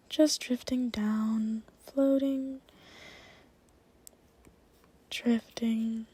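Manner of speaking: breathy hypnotic guidance; whispered hypnosis. Acted. Deepening the trance.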